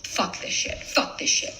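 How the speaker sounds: whispered swearing, under the breath; whispered fed-up refusal; whispered dismissal. Retold or imitated. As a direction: hiss it privately to yourself. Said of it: "I was like".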